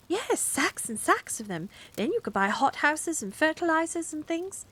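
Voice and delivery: eager. Acted. Carrie eagerly suggesting.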